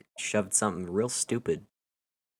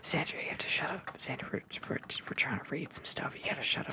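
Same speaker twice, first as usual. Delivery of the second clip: giggly prank whisper; 6 semitones higher, 8 dB quieter than usual. Real, not acted. Hushing Xander through giggles.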